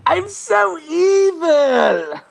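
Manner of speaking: very high, drawn-out villain voice; cartoon baddie, stretched and squeaked up high; evil banker. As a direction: wail it slowly as a hammed-up villain. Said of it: "if he talked like that".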